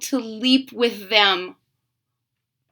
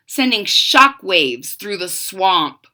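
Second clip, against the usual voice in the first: ordinary delivery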